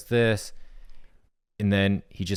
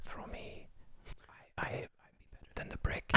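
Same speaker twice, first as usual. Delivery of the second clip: eerie whisper; ghostly whispered temptation; whispering ball; 20 dB quieter than usual. Acted. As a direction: whisper it like a seductive voice in his head. Ball voiced as whispering object.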